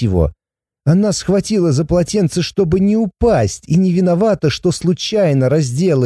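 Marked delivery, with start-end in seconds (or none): none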